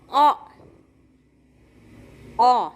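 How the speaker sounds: अ as a short lone "o/uh" vowel; phonic O sound. Shown as a demonstration. Teacher showing the sound of O, अ as short "o/uh". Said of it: "ओचा उच्चार होतो".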